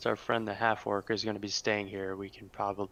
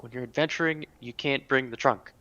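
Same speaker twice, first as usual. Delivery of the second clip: higher, louder exclamation; exclaiming; 5 semitones higher, 4 dB louder than usual. Acted. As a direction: call it out in exasperation. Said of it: "I kinda exclaim to her".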